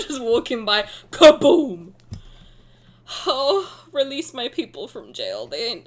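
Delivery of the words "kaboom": mock explosion.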